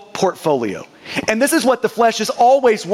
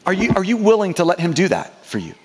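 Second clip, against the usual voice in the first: ordinary talk, nothing marked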